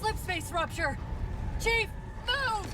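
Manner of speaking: frantic order to get moving; urgent shout. Acted. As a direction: shout him into action. Urgent escape during ship rupture.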